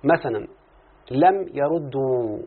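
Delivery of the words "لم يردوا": لم يردوا drawn out slowly and clearly; slow demo. Shown as a demonstration. Teacher slowly modelling the example for the student. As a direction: spell it out slowly. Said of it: "تقول يا مولانا مثلًا".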